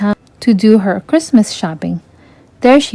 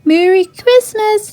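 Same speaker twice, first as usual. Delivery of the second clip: much higher, slow and happy; joyful reply; 12 semitones higher than usual. A narrator's reading. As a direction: sing it back gladly. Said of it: "Ariel replied".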